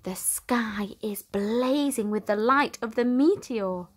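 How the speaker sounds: plain reading voice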